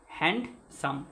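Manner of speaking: হ্যান্ডসাম broken into two beats, হ্যান্ড, সাম; two-syllable demo. Shown as a demonstration. Teacher demonstrates syllable count, হ্যান্ডসাম in two separate beats. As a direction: split হ্যান্ডসাম clearly after হ্যান্ড. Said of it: "দুটো অক্ষর আছে না?".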